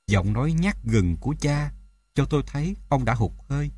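ordinary delivery, nothing marked

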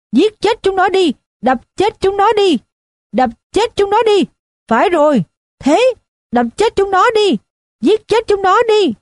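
put-on high, frenzied drunken yell; bay for blood like a drunk in a mob. A narrator's reading. Drunk on the chair urging the mob to kill, high yell. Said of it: "gào lên".

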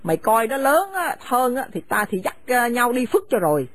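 harsh, contemptuous scolding of a daughter-in-law; bitter older woman driving her son's wife from the house; angry scolding mother. Acted. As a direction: spit the insults at her and order her out. Mother orders them out.

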